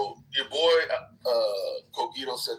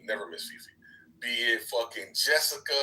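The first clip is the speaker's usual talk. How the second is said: usual speaking voice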